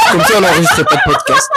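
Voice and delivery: laughing, high, loud and fast; teasing through a burst of laughter. Real, not acted. Se moque de lui en riant. (en riant, vite, aigu et fort)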